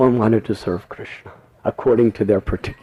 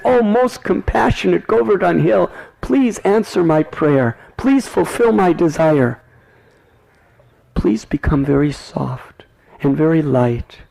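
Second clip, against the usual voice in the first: high, pleading mother's prayer; tearful prayer; 8 semitones higher than usual. Acted. Yashoda prays to Govardhan Hill, high pleading voice. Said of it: "with tears of love in her eyes".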